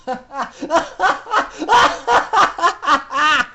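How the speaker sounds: loud, high villain cackle; evil mare's maniacal laughter. Acted. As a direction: throw the head back and cackle madly. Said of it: "threw her head back and laughed maniacally".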